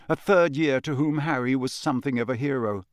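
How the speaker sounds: usual speaking voice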